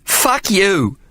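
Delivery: high, spat-out curse; a high furious curse; angry cry. A narrator's reading. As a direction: hurl it at him, high and furious. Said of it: "she cried".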